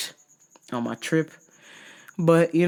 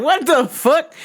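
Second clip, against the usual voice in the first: shocked outburst; 11 semitones higher, 4 dB louder, slower than usual. Retold or imitated. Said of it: "I was like".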